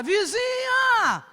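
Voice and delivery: high, very slow shout of one word; hollering for the neighbour next door; drawn-out shout. Retold or imitated. Man calling over to the neighbour's house, long high shout. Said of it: "Ele começou a gritar".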